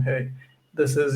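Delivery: ordinary delivery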